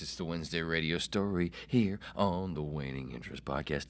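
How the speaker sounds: ordinary delivery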